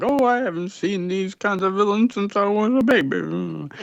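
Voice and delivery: a hint of a quavery old-timer reminiscing; mock old-timer marveling at the past; old guy voice. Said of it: "some old guy was like".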